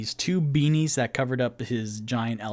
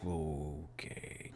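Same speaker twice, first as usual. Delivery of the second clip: quiet resigned okay; 9 semitones lower, 17 dB quieter than usual. Acted. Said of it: "quietly puts his".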